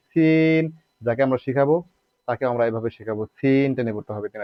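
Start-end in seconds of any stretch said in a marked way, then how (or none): 0.1-0.6 সিন with the ee drawn out; stretched, steady সিন; elongated vowel. Shown as a demonstration. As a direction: hold the vowel of সিন on a level tone. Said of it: "এখন আমরা টেনে পড়বো".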